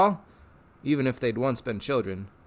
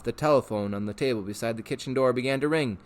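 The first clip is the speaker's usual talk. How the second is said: ordinary delivery, nothing marked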